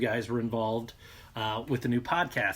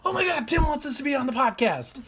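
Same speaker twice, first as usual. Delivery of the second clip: high-pitched excited gush; freaked-out excitement; 13 semitones higher than usual. Retold or imitated. Telling how Tim's invitation landed, freaked-out and high. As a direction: squeal it out, retelling a thrilled reaction. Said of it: "And I freaked out".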